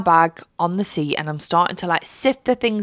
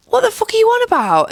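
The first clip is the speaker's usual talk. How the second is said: mock outrage; 5 dB louder than usual. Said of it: "I was like".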